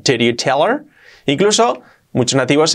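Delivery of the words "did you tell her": "did you tell her" as "deriu teler"; linked, H omitted. Shown as a demonstration. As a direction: glide through "did you tell her", rising at the end. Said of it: "Así sería".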